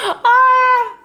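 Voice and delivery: high loud tearful wail; tearful cry out. Real, not acted. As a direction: let out a sudden crying wail. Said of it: "I'm a mess".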